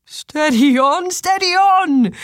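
put-on slow, high call after a runaway child; drawn-out fatherly holler. A narrator's reading. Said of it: "called Willem, running after her".

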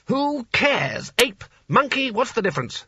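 high-pitched, exasperated outburst; irritated retort. A narrator's reading. Sconner dismissing the small wizard's correction, irritated. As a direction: throw the correction back with contempt. Bully snaps back at correction.

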